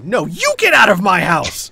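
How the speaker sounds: high, loud outraged shriek; screaming baroness. Acted. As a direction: scream them out of your house. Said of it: "the Baroness screams at you".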